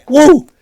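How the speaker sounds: loud, high "woo"; piercing cheer; excited whoop. Real, not acted. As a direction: let out a sharp high whoop. Excited whoop while calling out heights.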